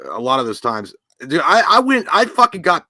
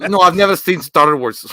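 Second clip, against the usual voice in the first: louder, a hint of a Russian accent; mock Russian accent; 4 semitones higher, 6 dB louder than usual. Host quoting the movie Russian in an accent. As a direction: quote the movie Russian, hammed up. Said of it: "And he's like".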